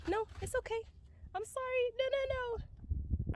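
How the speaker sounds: high but soft, nervous reassurance; calming the horse while afraid; anxious soothing. Real, not acted. Soothing the horse, still scared.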